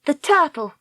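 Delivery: put-on high-pitched girl character voice; Dakota, composed young heroine. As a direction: state the demand coolly, pitched high.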